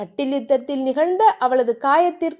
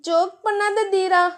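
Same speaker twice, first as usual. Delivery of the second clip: put-on higher, bashful girlish voice; flustered young wife; shy heroine; 6 semitones higher than usual. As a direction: brush him off shyly, voice lifted.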